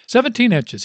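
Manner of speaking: high, booming confirmation that fills the hall; bellowing. A narrator's reading. Old coach answers four thousand coaches, bellowing. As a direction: boom it out to the whole room. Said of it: "his voice bellowing off the walls".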